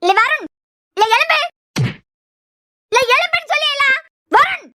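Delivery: high, insistent commands; angry wake-up order. Acted. Mother rousing sleeping Varun, angry wake-up order. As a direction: order him up, voice climbing sharply.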